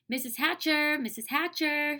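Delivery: put-on high-pitched girl calling for attention; eager high call. A narrator's reading. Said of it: "Sheila called".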